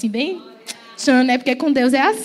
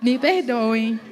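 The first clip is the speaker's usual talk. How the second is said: usual speaking voice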